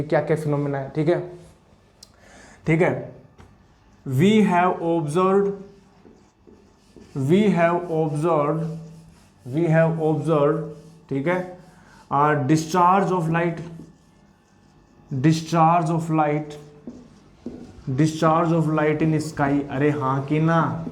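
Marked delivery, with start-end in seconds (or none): 4.1-10.6 very slow, each word spaced; slow dictation. Shown as a demonstration. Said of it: "dictating notes slowly". 12.1-19.7 very slow, each word spaced; slow dictation. Shown as a demonstration. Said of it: "dictating notes slowly".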